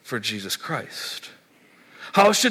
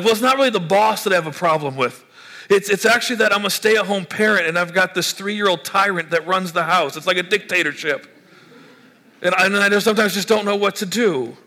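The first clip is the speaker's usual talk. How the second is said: mock-exasperated griping, higher and louder; exasperated parent; 6 semitones higher, 4 dB louder than usual. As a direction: vent like a worn-out parent of a toddler. Stay-at-home parent complaining.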